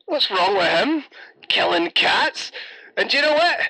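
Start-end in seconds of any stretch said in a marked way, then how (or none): none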